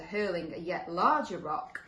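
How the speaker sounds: plain reading voice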